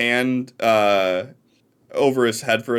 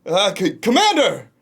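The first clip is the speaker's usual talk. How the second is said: nervous call; 9 semitones higher than usual. Acted. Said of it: "they yell out".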